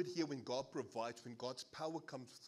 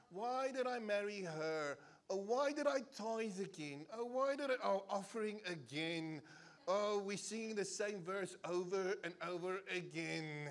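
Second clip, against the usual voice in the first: slow, higher, moaning self-pity; whining churchgoer; 5 semitones higher than usual. Pretend. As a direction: drag each complaint out like a sulky member. Whining churchgoer's complaints, impersonated.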